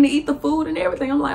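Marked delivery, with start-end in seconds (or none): none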